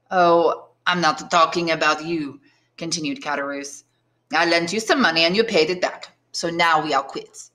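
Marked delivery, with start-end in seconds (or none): 0.1-2.4 put-on offhand, thick Marseille French accent; heavy Marseille accent. Tailor corrects startled Edmond, heavy Marseille accent. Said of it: "in a heavy Marseille accent". 4.3-7.5 put-on matter-of-fact, thick Marseille French accent; heavy Marseille accent. Tailor calls the debt settled, Marseille accent. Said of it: "in a heavy Marseille accent".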